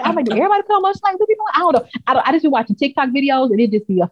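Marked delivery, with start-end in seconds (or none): 0.2-1.5 very fast, high-pitched retold question; incredulous rush; baffled questioning. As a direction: rattle it off in high-voiced bewilderment. Retelling own baffled question.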